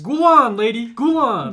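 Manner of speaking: loud, high cheering; excited cheer. Real, not acted. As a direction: hype her up. Cheering the ghoul on.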